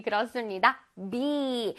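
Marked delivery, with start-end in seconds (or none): 1.1-1.7 "bee" with a long, drawn-out ee; long vowel in bee. Shown as a demonstration. Teacher modelling bee for the children, long vowel. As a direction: hold the ee in "bee". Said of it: "길게 발음해줘야 돼".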